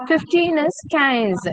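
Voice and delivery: slow "quinze" with a hard k start and nasal vowel; French quinze. Shown as a demonstration. Teacher repeating quinze for a student. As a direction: draw out "quinze" slowly from a clear k. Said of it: "Q U is pronounced with the sound K".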